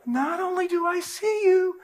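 high, slow, rising gloat; manic triumphant gloat. Retold or imitated. Grabbing the vandal triumphantly.

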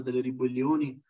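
ordinary delivery

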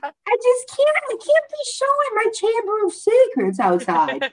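high, squeaky mock horror; mock dismay. Telling the story of her swimsuit to a friend, high mock horror. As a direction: squeal it like you're scandalised. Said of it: "and I said".

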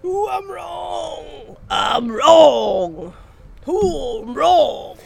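hammed-up wail of defeat; overplayed loud lament; mock wailing. Mock wailing over being wrong.

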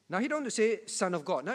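normal talking voice

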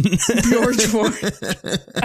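laughing. Real, not acted. Repeating the name laughing.

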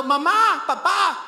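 high, loud scared shout for mom and dad; child crying out at night. Acted. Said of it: "despertando en la noche y gritando".

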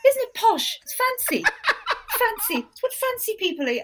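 very high, giggly mock-posh squeal; hammed-up delight at being fancy; Laura Whitmore. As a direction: squeak it like an excitable impression. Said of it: "she's kind of going".

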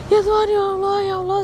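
high, wailing plea to God; retold panic. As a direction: re-enact crying out to God in fright. Re-enacts panicked cries to God.

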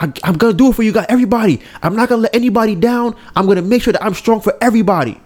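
high, rushed mock vow; going crazy. Strong kid vowing to protect everybody, retold as frantic, high-pitched pledge. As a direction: imitate a hero's frantic promise, words tumbling out. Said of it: "he's going crazy 'cause he's like".

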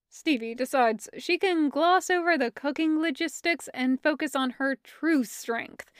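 ordinary delivery, nothing marked

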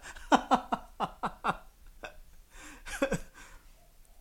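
long high rising giggle; laughing at the coincidence. Real, not acted.

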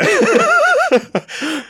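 a squealing, high-pitched burst of laughter. Real, not acted. Host cracking up at his own Aussie cooking-voice joke, squealing laugh. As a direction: crack up helplessly, high and loud.

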